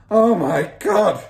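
long, loud horrified cry; father's yell. A narrator's reading. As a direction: yell in drawn-out horror. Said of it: "yelled the father".

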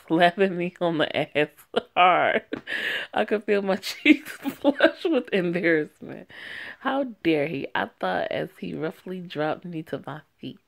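ordinary talk

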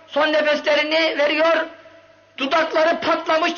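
usual speaking voice

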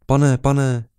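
put-on high, loud child's call; little boy calling. A narrator's reading. Small beggar boy calling to the officer, high child's voice. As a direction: pipe up to get his attention. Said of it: "ozval se hlas za jeho zády".

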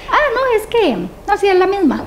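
jumpy high mock-flustered excuse; flustered fib. Mother caught lying to her child about the turtle, flustered high excuse. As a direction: squeak out a caught-out lie, half-hearted.

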